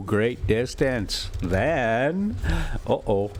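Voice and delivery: usual speaking voice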